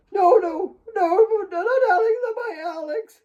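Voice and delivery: high, crying no, fading at the end; wailing cry trailing off; sobbing denial. A narrator's reading. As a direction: break down in tears. Kate breaking down.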